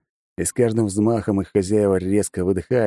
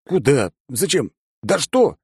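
panicked questions; 7 semitones higher, slower than usual. Acted. Bewildered narrator.

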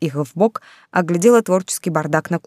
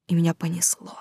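шёпот; 3 semitones lower, 7 dB quieter than usual. A narrator's reading. Шёпот в пересказе.

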